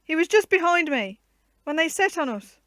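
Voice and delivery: loud, high anguished shout; distressed cry. A narrator's reading. Knight crying out for his missing groom, distressed loud cry. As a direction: cry out for the lost servant. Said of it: "The burly knight was concerned and unhappy".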